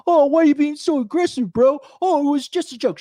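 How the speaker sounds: high, whiny imitation of a defensive bro; mock a weak guy's excuses in a high whine; sneering falsetto bro. Mocking whiny internet guy.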